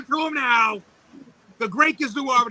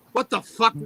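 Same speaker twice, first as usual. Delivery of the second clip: ordinary talk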